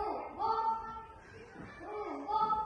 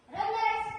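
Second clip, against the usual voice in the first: higher, louder drill call; shouting drill sergeant; 4 semitones higher, 6 dB louder than usual. Real, not acted. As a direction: call the squad to attention. Commander lines up the squad.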